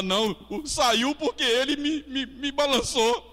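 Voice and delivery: high-pitched, stammering self-defence; protesting excuse. Acted. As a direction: act out someone shifting the blame. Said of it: "A sua tendência é falar".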